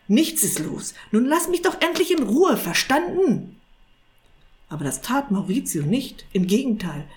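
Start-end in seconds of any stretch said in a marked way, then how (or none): 0.1-3.6 nervous, irritated. A narrator's reading. Sorcerer wanting to be left in peace, irritated. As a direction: wave off the questions, voice jumping high. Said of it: "Irrwitzer winkte nervös ab".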